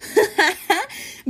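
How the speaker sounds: high laughing cackle. Acted. Palace laughs at friend.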